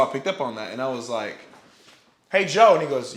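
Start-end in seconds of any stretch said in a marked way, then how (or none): 2.3-2.7 louder, higher retold hail; calling out. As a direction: imitate hailing someone in passing. Retelling himself calling out to Joe.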